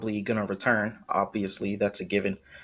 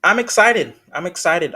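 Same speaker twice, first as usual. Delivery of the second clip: excited; 7 semitones higher, 8 dB louder than usual. Real, not acted. Reacting eagerly after the trailer.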